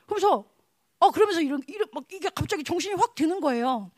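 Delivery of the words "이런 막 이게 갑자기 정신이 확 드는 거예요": ordinary talk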